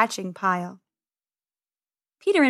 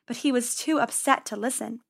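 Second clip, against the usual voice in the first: usual speaking voice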